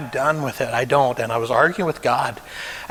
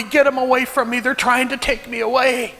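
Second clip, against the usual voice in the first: retold scream; 11 semitones higher than usual. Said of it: "he woke up screaming".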